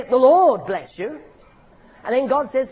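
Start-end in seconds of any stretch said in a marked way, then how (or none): 0.0-1.2 slow, raised blessing with a rising end; loud blessing. Shown as a demonstration. Preacher showing the congregation how to bless, slowly and loudly. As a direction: call the blessing out, slow and full. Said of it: "We say".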